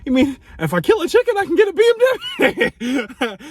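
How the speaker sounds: high, loud, eager put-on voice that climbs to the end; friend's mock excitement. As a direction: play it like a friend suddenly tempted. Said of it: "just the way she said it was kinda like".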